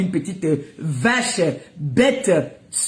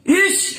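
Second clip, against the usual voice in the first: disgusted yelp; 10 semitones higher than usual. Real, not acted. Disgusted exclamation at rival.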